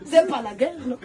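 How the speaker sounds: rising indignant protest; indignant retort. Retold or imitated. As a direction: snap back it's not war. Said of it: "Tu peux demander poliment".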